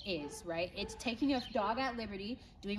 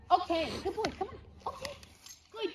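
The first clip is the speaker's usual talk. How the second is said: high coaxing call; 6 semitones higher than usual. Real, not acted. Coaxing the dog to come.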